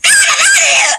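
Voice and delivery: high playful shout of no; excited refusal. Retold or imitated. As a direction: squeal it like a gleeful kid. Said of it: "And I'm like".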